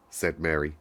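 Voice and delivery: plain reading voice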